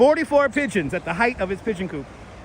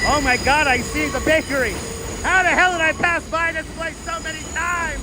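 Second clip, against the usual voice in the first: higher, delighted disbelief; thrilled to spot the bakery at last; excited discovery; 10 semitones higher than usual. Real, not acted. Streamer spotting the bakery on his walk, delighted disbelief.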